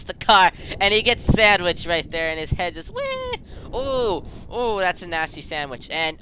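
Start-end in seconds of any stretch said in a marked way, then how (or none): 2.9-3.4 very high, short "wee"; squealed wee. Acted. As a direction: squeak it as if sent flying. Voices the head flying off.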